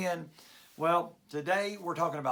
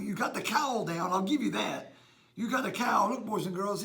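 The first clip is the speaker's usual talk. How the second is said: usual speaking voice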